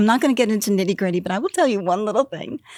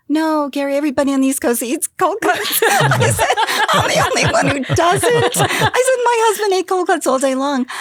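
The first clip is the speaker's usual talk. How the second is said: laughing protest; 9 semitones higher, 6 dB louder than usual. Retold or imitated. Retelling argument with laughter.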